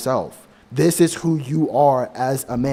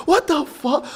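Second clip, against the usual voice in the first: shocked yelp; 13 semitones higher than usual. Retold or imitated. Second, bigger take of the reaction.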